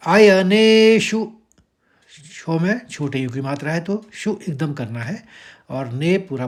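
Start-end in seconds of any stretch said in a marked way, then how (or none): 0.1-1.3 "अयनेषु" chanted slowly, ने said in full, शु kept short; slow recitation. Shown as a demonstration. Teacher demonstrating "अयनेषु" slowly, high and loud. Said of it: "तो सीधे सीधे हम पढ़ लेंगे।".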